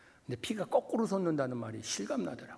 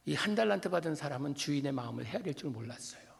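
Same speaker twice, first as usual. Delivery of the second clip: ordinary talk, nothing marked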